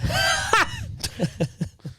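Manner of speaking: a high, shouted burst of laughter; imitated teacher laugh. Teacher laughs at IBS note.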